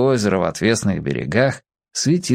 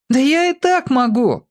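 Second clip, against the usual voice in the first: put-on defiant boy; 13 semitones higher than usual. A narrator's reading. Boy answers the taunts.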